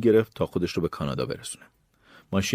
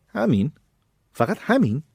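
high-pitched incredulous question; indignant and unimpressed; scornful disbelief; 8 semitones higher, 3 dB louder than usual. A narrator's reading. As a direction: question it sharply, pitch jumping up. Robber scorns the little money.

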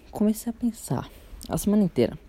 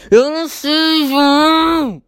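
high, loud mom voice, mock yell; exasperated mom; 10 semitones higher, 8 dB louder, much slower than usual. Mom fed up with João's begging, loud high mock yell. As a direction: imitate mom snapping back, loud and high. Mom loses patience with son.

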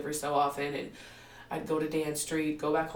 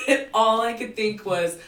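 laughing; 3 semitones higher, 9 dB louder than usual. Real, not acted. Laughing retelling.

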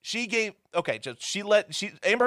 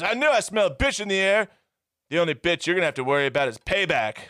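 loud, high mock tough-girl line easing into quick delivery; hammed-up fight-scene trash talk; bad movie dialogue; 3 semitones higher, 4 dB louder, faster than usual. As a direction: ham up a cheesy action-movie threat. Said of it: "some pretty bad dialogue".

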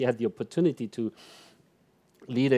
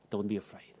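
softer, gentle promise; soft reassurance; 4 semitones lower, 11 dB quieter than usual. Acted. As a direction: comfort a frightened listener. Jesus quote, first take.

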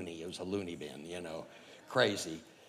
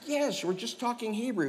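much higher, exasperated insisting; emphatic insisting; 14 semitones higher than usual. Retold or imitated. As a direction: tell him once more, firmly. Insisting again.

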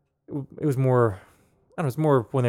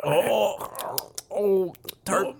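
mock pained tart; 6 semitones higher, 4 dB quieter, much slower than usual. Mimicking reaction to sour beer.

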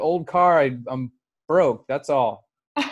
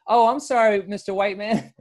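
apologetic cop; 6 semitones higher, 3 dB louder than usual. Retold or imitated.

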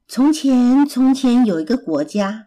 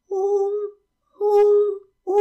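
bee buzzing; 9 semitones higher, faster than usual. A narrator's reading. Little bee suddenly flying over, buzzing. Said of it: "一只小蜜蜂飞过来".

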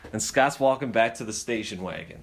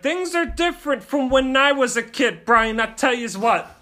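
high, loud scolding father; lecturing dad voice; 10 semitones higher, 6 dB louder than usual. Retold or imitated. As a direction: lecture like an old-school dad. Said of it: "And he's like".

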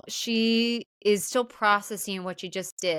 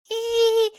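high, loud coy squeal; imitated flirty titter; flirty giggle; 8 dB louder than usual. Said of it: "She's being a little flirty".

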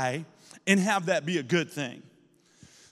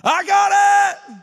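high, loud triumphant holler; imitated ecstatic yell, stretched out; 12 semitones higher, 9 dB louder than usual. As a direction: shout it out like an overjoyed kid. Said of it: "he hollers out".

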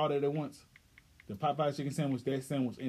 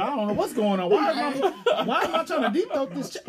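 louder and slower through laughter; laughing; 4 semitones higher, 3 dB louder, slower than usual. Real, not acted. Host refuses the huge sandwich, laughing hard. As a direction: crack up while protesting.

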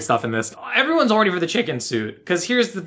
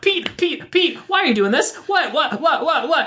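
high, disbelieving calls; incredulous yelling; 10 semitones higher than usual. Retold or imitated. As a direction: shout in stunned disbelief. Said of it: "And I was like".

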